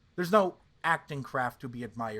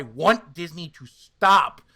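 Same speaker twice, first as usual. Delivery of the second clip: louder, slower insistence; forceful demand; 2 semitones higher, 11 dB louder, slower than usual. Real, not acted. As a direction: push the demand hard. Ranting against digital Luke.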